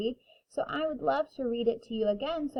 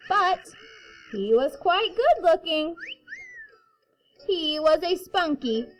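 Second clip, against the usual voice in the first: put-on reading to children; 4 semitones higher, 6 dB louder than usual. A narrator's reading. Reading picture book to kindergarten.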